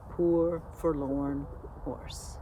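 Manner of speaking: ordinary delivery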